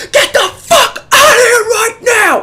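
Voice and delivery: full-force high-pitched yell; furious scream. Retold or imitated. Organizer screaming at kid to leave green room, furious scream. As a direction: scream it, stretching the words. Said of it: "I'm screaming now".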